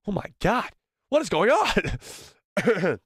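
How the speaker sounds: strange strained voice, slow and high; voice suddenly changed. Real, not acted. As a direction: voice going odd mid-sentence. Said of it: "Somebody just enchanted my voice".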